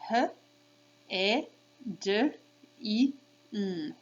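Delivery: H, E, D, I, N as separate phonemes; sounded out. Shown as a demonstration. Teacher leading kids along, sounded out. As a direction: say H, E, D, I, N by sound, pausing between. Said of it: "Try it one more time".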